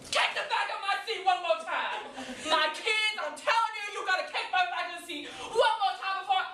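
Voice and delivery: high-pitched threatening yell; exasperated car-seat tirade; furious mother scolding. Acted. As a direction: warn the kids at full tilt. Mother scolds kids in back seat.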